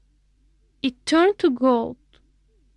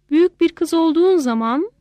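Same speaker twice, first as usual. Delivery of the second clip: plain reading voice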